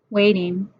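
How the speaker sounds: ordinary delivery, nothing marked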